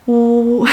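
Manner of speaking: "oo" as one long, steady sound; vowel demo. Shown as a demonstration. Teacher modelling letter U for learners, "oo" held long and steady. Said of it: "It has a sound of".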